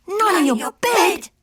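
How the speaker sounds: high, drawn-out shocked exclamation; exclaiming. A narrator's reading. All the doggies react to the news, high exclaiming. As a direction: cry it out high and slow. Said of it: "exclaimed all the doggies".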